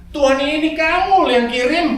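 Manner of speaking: seruan tinggi dan keras kepada Tuhan, setengah diperankan; protes memelas. Retold or imitated. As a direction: mengeluh keras ke Tuhan seperti orang putus asa. Protes kepada Tuhan soal istri. (suami mengeluh kepada Tuhan soal istrinya, dengan suara tinggi memelas)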